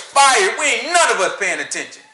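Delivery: ordinary delivery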